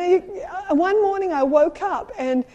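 usual speaking voice

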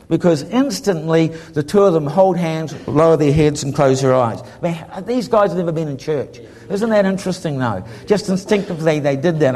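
normal talking voice

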